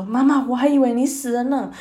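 joyful shout. Acted. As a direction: call out as if she came back to life. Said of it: "指挥官就大喊说".

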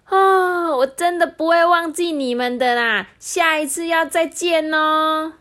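high, bright promise not to forget; earnest kid saying goodbye; Xiaogua farewell. Acted. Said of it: "小瓜就说".

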